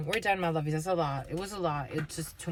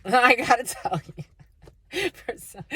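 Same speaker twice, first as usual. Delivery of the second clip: laughing; 6 semitones higher, 6 dB louder than usual. Real, not acted. Laughing at her own reading.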